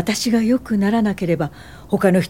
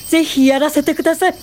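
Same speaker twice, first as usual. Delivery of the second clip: quick, higher, bursting with eagerness; eager acceptance; 6 semitones higher, faster than usual. Acted. Accepting her mentor's offer to help build the school, eager and quick. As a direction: jump at the chance, fast and bright.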